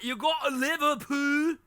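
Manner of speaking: high-pitched put-on Liverpool accent. Shown as a demonstration. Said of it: "there's different British accents".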